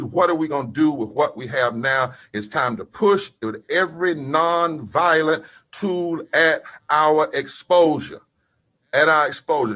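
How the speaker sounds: rising, rolling preacher's cadence; preaching at full force. Acted. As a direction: preach it out to the crowd, hitting each phrase. Preacher rousing a rally.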